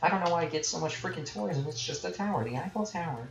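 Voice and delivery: high, swooping swoony imitation of a woman; mock gushing woman. Streamer mocking the Paris cliche, hammed-up gushing woman. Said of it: "like, every woman is like".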